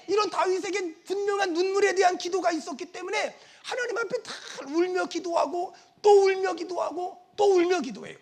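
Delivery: ordinary delivery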